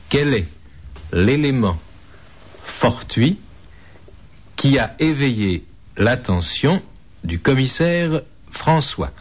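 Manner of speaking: slow, with long pauses between words; slowly. Shown as a demonstration. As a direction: spell it out slowly, word by word. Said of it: "Je répète lentement".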